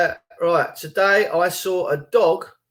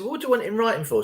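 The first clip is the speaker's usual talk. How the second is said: fast, higher, dismissive council woman; quick mocking council woman; 6 semitones higher, 5 dB quieter, much faster than usual. Council woman brushing off the request, fast and higher. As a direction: toss it off like a dismissive official. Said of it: "this woman said".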